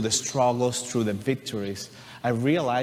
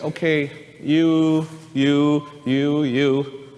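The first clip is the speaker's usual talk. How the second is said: louder, slow calling out of people; officer calling out; 3 semitones higher, 6 dB louder, much slower than usual. Retold or imitated. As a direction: call each one forward from the crowd.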